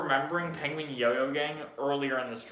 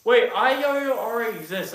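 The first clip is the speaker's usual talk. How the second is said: surprised; 7 semitones higher, 8 dB louder than usual. Real, not acted. Realizes name is taken.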